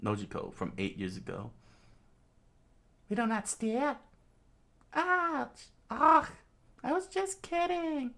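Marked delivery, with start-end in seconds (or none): 3.1-4.0 high, put-on woman's voice; pitched-up mother voice; foster mother correcting. Belmere gently corrects the children. 4.9-8.1 put-on very high, squeaky kid yelp; tiny high voice, hurt protest; protesting little girl. Child Nojiko reacts to being hit.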